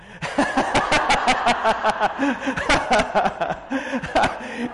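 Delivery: loud, high burst of laughter; real laugh. Real, not acted. Speaker amused at the BMW guy's remark, hearty laugh.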